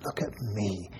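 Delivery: soft and low, barely voiced; quiet tender voice; gentle. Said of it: "very gently and says".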